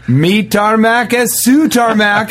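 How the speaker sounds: high, slow hammed-up welcome; lightly hammed-up, drawn-out greeting; mock Spanish host. Host greeting a friend, high slow mock welcome. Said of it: "be like".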